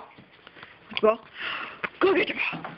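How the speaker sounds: high, slow teasing dog voice; high slow teasing call to fetch; pet-talk fetch. Real, not acted.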